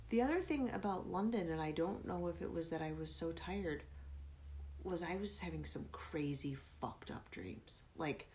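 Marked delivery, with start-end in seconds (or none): none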